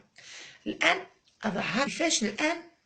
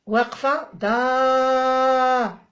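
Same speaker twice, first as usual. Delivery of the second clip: drawn-out, slow دا; 4 dB louder, much slower than usual. Shown as a demonstration. Mother showing the child the fatha sound, very slow. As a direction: stretch the words out slowly. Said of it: "تبدأ الأم".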